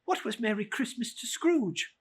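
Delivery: plain reading voice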